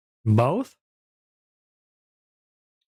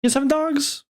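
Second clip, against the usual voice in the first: surprised; 12 semitones higher than usual. Real, not acted. Amazed reply about the dogs.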